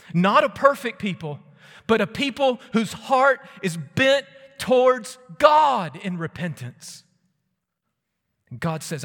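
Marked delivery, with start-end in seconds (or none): 0.1-7.0 high, loud pulpit shout; preaching at full force. Acted. Preacher describing a repentant people, shouted preaching. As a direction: proclaim it high and loud, drawing out the end.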